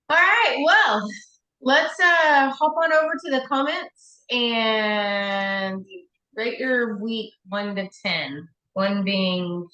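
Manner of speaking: slow, upbeat show-host delivery; host announcer voice. Acted. Host invites ratings to comments.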